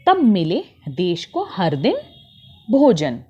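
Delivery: usual speaking voice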